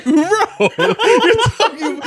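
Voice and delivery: high, loud, slow laughing protest. Retold or imitated. Scolding a friend's rap line, laughing high. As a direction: stretch it out through laughter. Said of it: "And I was like".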